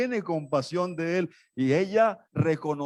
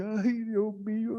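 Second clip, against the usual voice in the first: mock anxious sigh; 5 semitones higher, 4 dB quieter than usual. Mimics anxious praying believer.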